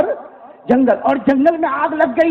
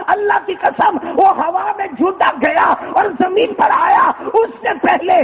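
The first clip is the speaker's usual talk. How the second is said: fervent preaching; 5 semitones higher than usual. Acted. Preacher telling the miracle to the congregation, tearful fervour. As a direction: cry it out in awe.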